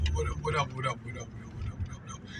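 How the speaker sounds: what up, trailing off to a whisper; fading repeated greeting. Retold or imitated. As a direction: let the greetings fade to nothing. Voicing the young boys' greetings.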